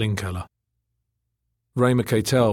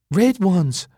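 high, louder delighted cry; excited; 10 semitones higher, 5 dB louder than usual. A narrator's reading. Elena spots the food tokens, high and excited. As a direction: exclaim it in a high woman's voice. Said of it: "she said excitedly".

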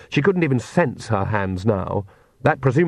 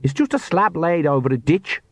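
higher-pitched, lightly insistent character voice; 6 semitones higher than usual. A narrator's reading. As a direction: insist it's nothing to worry about. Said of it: "Perdita insisted".

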